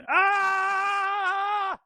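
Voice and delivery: long high-pitched panicked scream; sustained acted scream. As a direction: hold a shrieking "ah" while firing wildly. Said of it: "While screaming".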